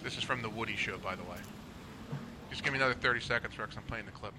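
low, soft aside over a clip; quiet, low-pitched side remark; hushed aside. Real, not acted. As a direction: keep it down while the clip plays. Aside while the clip plays.

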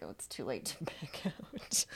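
whispered, slow dawning dread; hushed too-late realisation. Retold or imitated. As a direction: mutter it to yourself with a nervous laugh. Realising she can't escape.

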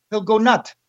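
lightly voiced French Canadian accent, dropping word endings; a hint of Pat's French Canadian accent; a touch of a French Canadian trainer. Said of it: "Yeah, do the accent".

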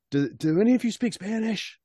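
high called-out question; yelled down the stairs. Acted. To the party downstairs, a high called-out question. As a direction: call it down the stairs with the pitch way up. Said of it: "I yell that down the stairs".

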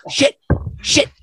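high, panicky shit shit shit; mock panic. Friend running from the dad caught smoking, high mock panic. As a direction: flee in a panic. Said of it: "DiMattio's running down the street".

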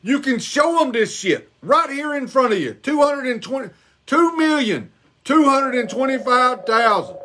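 usual speaking voice

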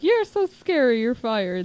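mock southern lady firing him; southern accent. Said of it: "doing her with a southern accent".

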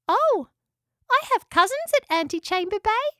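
very high, squeaky voice; little echidna. A narrator's reading. Spike mentions cousins to the house, little echidna voice. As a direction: pipe up in a tiny creature's voice.

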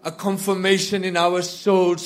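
ordinary talk, nothing marked

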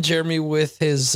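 ordinary talk

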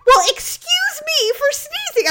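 loud, very high, drawn-out mock indignation; hammed-up huffy comeback; indignant retort. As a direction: imitate an offended husband, slow and loud. Acting husband retorting to wife.

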